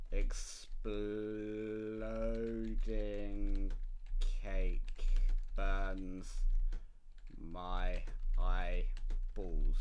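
slow, drawn-out announcer reading; mock epic title read; dramatic title. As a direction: stretch each word like a trailer title.

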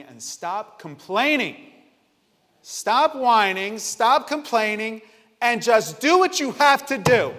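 loud, high, slow-hammered orders; acted drill-like yelling, each word drawn out; building scolding shout. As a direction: build to shouting at yourself to quit.

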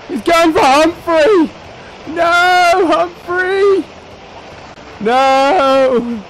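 high, loud, drawn-out wail; mock distress. Player watching Humphrey get attacked, high wail. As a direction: cry out in hammed-up horror. Mock grief for his companion.

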